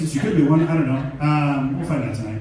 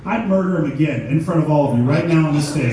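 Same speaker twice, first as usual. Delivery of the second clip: loud, furious rant; comic rant; 6 dB louder than usual. Acted. Stand-up comic ranting at the crowd in fake fury. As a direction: tear into them.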